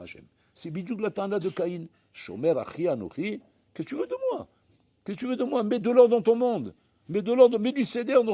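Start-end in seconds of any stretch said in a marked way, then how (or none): 3.7-8.3 much higher then loud commanding; mock Cain-like retort. God answering the complaining man, loud command. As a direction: snap back, then order it set right.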